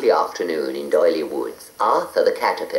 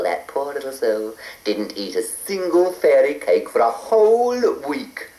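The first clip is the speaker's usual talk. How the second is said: ordinary delivery, nothing marked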